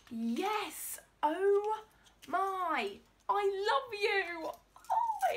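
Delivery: high, loud ecstatic squeal; shrieking jackpot winner. Real, not acted. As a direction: squeal with pure delight. Overjoyed at Harry Potter gift.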